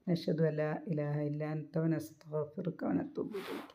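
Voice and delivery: slow, soft Arabic dua recited reverently; closing prayer. Real, not acted. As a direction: ease into a hushed, slow prayer to close the lesson.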